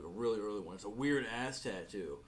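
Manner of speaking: usual speaking voice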